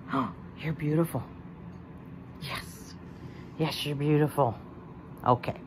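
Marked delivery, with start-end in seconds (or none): none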